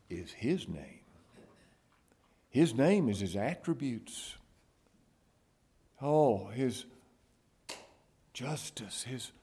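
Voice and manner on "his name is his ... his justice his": ordinary delivery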